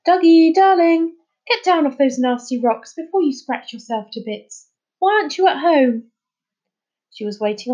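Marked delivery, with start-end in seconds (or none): 0.1-6.1 high, loud doting mum voice; fussy mother calling. A narrator's reading. Mother calling her son down from the rocks, fussy. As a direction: call out sweetly but insistently. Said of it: "calling out".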